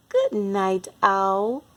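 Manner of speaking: ordinary delivery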